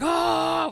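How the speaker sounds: high excited group scream; kids screaming. Retold or imitated. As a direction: shriek in delight like schoolkids. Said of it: "fucking screaming".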